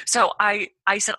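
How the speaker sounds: usual speaking voice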